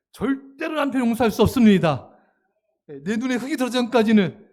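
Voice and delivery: loud, emphatic mock vow; defiant refusal. Church member refusing to forgive her husband, defiant outburst. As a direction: declare it forcefully, imitating an unforgiving wife. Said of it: "시험에 든 분이 있어요".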